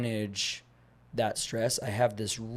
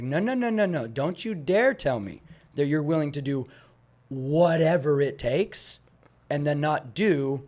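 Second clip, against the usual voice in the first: firm retold scolding; 4 semitones higher, 6 dB louder than usual. Trainer retelling how he scolded a client, firm and raised. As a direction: lay down the law to a client who is backing out.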